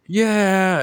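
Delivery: loud, high whooping yeah; cheering. Retold or imitated. Team reacting after Bucky flips that car, cheering. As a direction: imitate a crowd's big cheer. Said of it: "they're all cheering".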